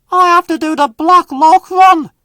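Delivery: high, loud indignant huff; huffy James. Acted. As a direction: complain loudly like a proud engine. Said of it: "huffed James".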